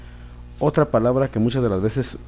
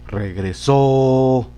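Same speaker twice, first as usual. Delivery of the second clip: "Regresó" with SÓ held long and level; stress on last syllable; 3 dB louder, much slower than usual. Shown as a demonstration. Teacher recalling the instruction, long stress on SÓ in "Regresó". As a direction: draw out the final O of "Regresó". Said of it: "acentuar en la última sílaba".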